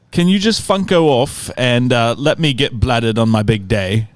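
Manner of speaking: hammed-up London uncle; butchered London accent. Nephew reads out his uncle's birthday reply, butchered London accent. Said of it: "I'm sure I will butcher his accent".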